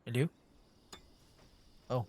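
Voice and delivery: ordinary talk, nothing marked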